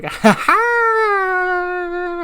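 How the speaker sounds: high, loud goofy "ha ha" laugh; a silly high cackle as he walks in; Ricochet's stupid laugh. Retold or imitated. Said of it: "we hear Ricochet's stupid laugh".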